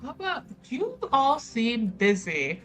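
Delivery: ordinary talk, nothing marked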